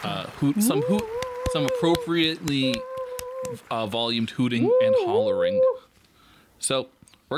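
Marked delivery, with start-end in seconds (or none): 0.0-5.6 high, loud whooping cheer; hooting and hollering. Pretend. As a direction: hoot like a cheering crowd. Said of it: "putting in a little bit of applause".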